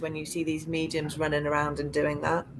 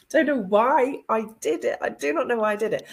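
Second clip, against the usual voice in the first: ordinary talk, nothing marked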